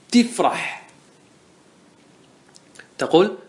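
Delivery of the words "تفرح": "تفرح" with a kasra on the ta, tifrah; Tamimi kasra prefix. Shown as a demonstration. Teacher to class, showing the Tamimi kasra, clear demo. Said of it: "يجوز لك أن تكسر".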